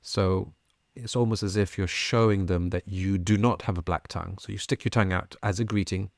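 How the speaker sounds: ordinary talk, nothing marked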